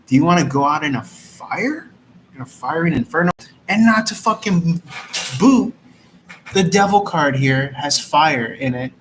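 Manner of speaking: rising, disbelieving rant; incredulous rant. Real, not acted. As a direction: build to a squeal.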